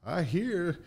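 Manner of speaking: high-pitched put-on presidential voice; President Clinton. Retelling the president's compliment, high imitated voice. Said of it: "and he said".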